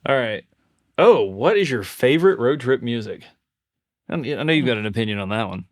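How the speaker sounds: ordinary talk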